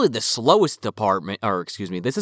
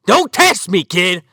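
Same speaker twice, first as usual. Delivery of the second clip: raised, louder mock dad warning; mock angry threat; 5 semitones higher, 5 dB louder, slower than usual. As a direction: play an angry dad laying down the law.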